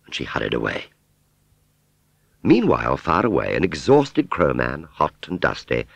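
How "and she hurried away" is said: ordinary delivery, nothing marked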